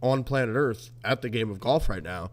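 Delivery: ordinary talk, nothing marked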